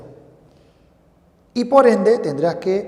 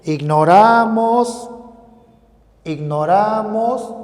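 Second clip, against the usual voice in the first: slow demo; 3 semitones higher, 5 dB louder, slower than usual. Shown as a demonstration. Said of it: "Y decimos".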